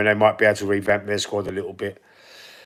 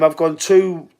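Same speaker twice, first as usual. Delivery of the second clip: usual speaking voice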